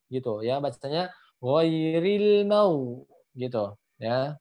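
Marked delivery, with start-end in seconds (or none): 1.4-3.0 ghairil mau slow, with thick throaty gh; ghain tebal. Shown as a demonstration. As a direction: make the gh heavy in ghairil mau. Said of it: "dia jadi tebal gitu". (ghairil mau pelan, gh tebal)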